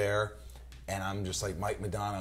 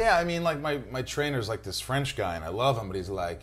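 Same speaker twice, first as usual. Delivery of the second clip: ordinary talk, nothing marked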